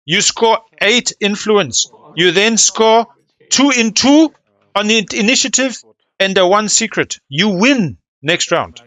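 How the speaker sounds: loud, high, insistent tally; excited. Real, not acted. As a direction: hammer out each point excitedly.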